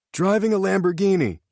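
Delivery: high-pitched yelled guess. A narrator's reading. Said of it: "Tanner yelled".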